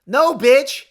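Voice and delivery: high, loud yelled retort; pretend furious shout. Telling off the specialist in a pretend furious shout. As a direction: yell the comeback he never said. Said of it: "I did not say that".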